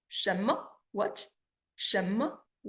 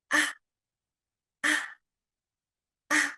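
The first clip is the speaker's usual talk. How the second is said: "Ah" light and very short; just touch "Ah" and let it go; neutral tone; 4 semitones higher than usual. Shown as a demonstration. Teacher showing learners the neutral tone, "Ah" light and short. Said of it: "neutral tones pronunciation is light and short".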